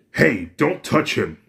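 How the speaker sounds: angry warning. Acted. Izuku warns his father off his brother, loud and angry. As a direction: snap in to defend your brother. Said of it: "This angers Izuku".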